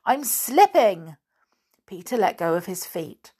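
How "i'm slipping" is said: slow, high, loud cry of alarm; calling out. A narrator's reading. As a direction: call out as your grip goes. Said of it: "called the boy on the bough above".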